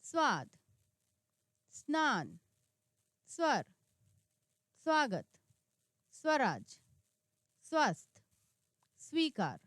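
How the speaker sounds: emphatic teacher reading of स्व words; word-list reading. Shown as a demonstration. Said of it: "आइए कुछ नए शब्द बनाते हैं".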